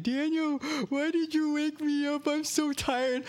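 very high, whiny crying imitation; hammed-up tearful complaint; mock crying. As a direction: wail like an upset little brother. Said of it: "he starts crying, saying".

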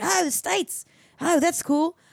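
Australian surfer. Retold or imitated. Surfer hears where they live, lightly mocked Aussie accent. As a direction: imitate an Australian's easygoing reply. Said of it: "this weird Australian surfer".

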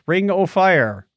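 normal talking voice

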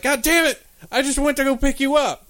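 high, exasperated curse; mock angry crane. Magnet crane losing the appliances, in high mock fury.

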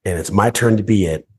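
ordinary delivery

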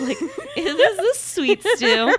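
high, drawn-out question through real laughter; laughing. Real, not acted. Cracking up with co-hosts over a mixed-up name, laughing.

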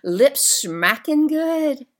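a light, slow Southern accent; Southern drawl. Shown as a demonstration. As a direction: savour it like a Southern cook bragging on her pie. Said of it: "That sounds like a Southern way of putting it".

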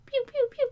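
high pew pew zaps; laser sound. Pretend. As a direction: zap like a toy laser. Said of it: "laser sound effect".

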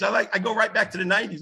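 usual speaking voice

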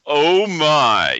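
a light George Takei impression. Host reading a fake legal letter, lightly voicing Takei. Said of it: "This is George Takei actually speaking".

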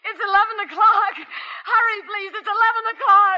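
high, tearful frantic pleading; near hysterical. Acted. Frightened wife begging the operator to hurry, tearful. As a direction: break down into panicked sobs.

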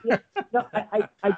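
high, laughing yeah no. Real, not acted. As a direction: answer through a real laugh. Laughing after blood-pressure joke.

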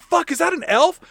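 high-pitched mock astonishment; shocked. Sheriff seeing an elf walk out, mock shocked. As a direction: blurt it out in hammed-up disbelief. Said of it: "Rodriguez is shocked to see".

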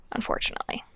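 unfortunately breathed out as a whisper; a dry whispered afterthought; whispered aside. Real, not acted.

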